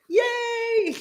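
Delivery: a high, laughing little yay; excited cheer. Real, not acted. To viewers about writing space, a high happy yay. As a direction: cheer with real delight.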